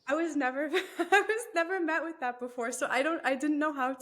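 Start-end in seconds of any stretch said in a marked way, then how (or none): 0.1-2.7 incredulous laugh through the words; laughing it off, louder. Real, not acted. Laughs recalling sexist classmates.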